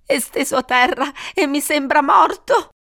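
high, tearful acted voice; sobbing. A narrator's reading. Wife describes the body to police, acted sobbing. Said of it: "singhiozzò lei".

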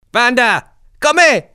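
very high, loud call; kid leader calling. Acted. Cicin calling to the gang from behind, high and loud. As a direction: shout after the gang to keep control.

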